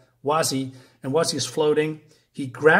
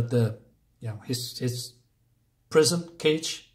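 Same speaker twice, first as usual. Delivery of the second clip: normal talking voice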